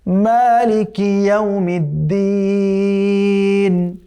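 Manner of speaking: slow, drawn-out melodic tajweed chant; chanted Quranic recitation. Shown as a demonstration. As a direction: recite the verse slowly with full tajweed.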